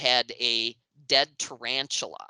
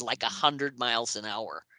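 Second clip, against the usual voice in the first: normal talking voice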